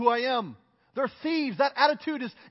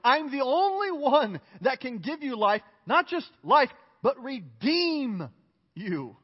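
fervent preaching; 3 semitones higher than usual. Acted. Pastor proclaiming Jesus' promise to listeners, fervent. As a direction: rise and proclaim hope.

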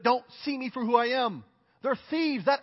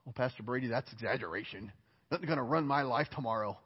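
lower, dismissive doubter; mock skeptic; 10 semitones lower, 7 dB quieter than usual. Imagined listener dismissing the pastor, lower and dismissive.

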